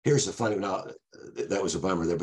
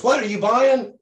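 much higher and louder goofy taunt; Ray's wild voice; 7 dB louder than usual. Retold or imitated. Passenger heckles the cop, high loud mocking yell. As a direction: yell it like a cackling movie gangster. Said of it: "Ray goes".

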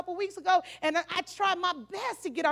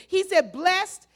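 ordinary talk, nothing marked

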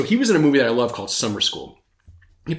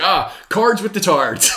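mock little-brother catchphrase, drawn out; slow, gleeful movie-line quote through a laugh; imitando o irmãozinho; 5 semitones higher, slower than usual. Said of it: "little brother's like".

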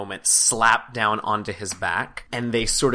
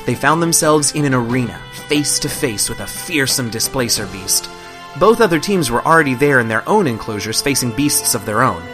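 narration; 2 semitones higher, 5 dB louder than usual. A narrator's reading. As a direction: lively recap narration. Game master narration recap.

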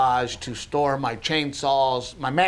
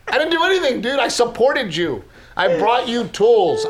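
exasperated protest; 7 semitones higher, 4 dB louder than usual. Retold or imitated. Acted protest in quarrel.

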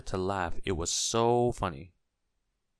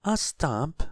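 high, louder prompting question; lifted, questioning shopkeeper voice; helpful suggestion; 8 semitones higher, 6 dB louder than usual. A narrator's reading. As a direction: offer it as a helpful guess. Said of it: "suggested Missus Parcel".

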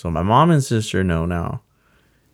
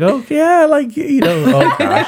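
high, loud, laughing mock of the brother; hyped laughing brother; 9 dB louder than usual. Brother hyping him up, loud and laughing. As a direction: play the big brother cheering through laughter.

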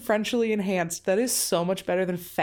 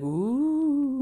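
long spooky ooh, sliding higher; ghostly ooh; 4 semitones higher than usual. Pretend. Podcast host shows what woo-woo means, ghostly. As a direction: wail it like a playful ghost. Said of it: "like ghosty".

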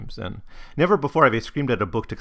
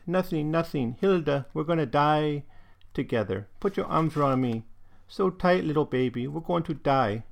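put-on higher-pitched, despairing German-accented mother; German dialect; 5 semitones higher than usual. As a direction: lament to your child in German-accented English. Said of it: "this is in this dialect".

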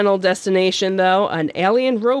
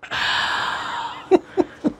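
corny dad laugh; 12 semitones higher, 4 dB quieter than usual. Real, not acted. Groaning at a pun.

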